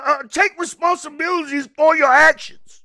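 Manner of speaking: normal talking voice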